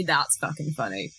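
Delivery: normal talking voice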